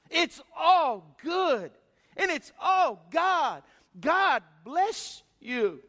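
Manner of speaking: very slow, loud, syrupy preacher send-off; mock feel-good preacher. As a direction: ham up a hype preacher's blessing. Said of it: "They'll tell you".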